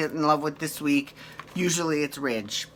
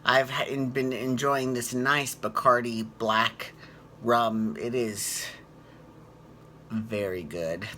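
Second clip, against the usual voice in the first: tipsy; 5 semitones lower, 5 dB quieter, slower than usual. Real, not acted. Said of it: "I'm a little tipsy".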